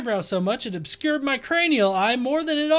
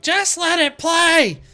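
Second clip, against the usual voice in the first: slow, louder, higher whiny demand; mock annoyed commenter; 7 semitones higher, 5 dB louder, slower than usual. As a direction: whine it like an impatient viewer. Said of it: "I get those kind of comments".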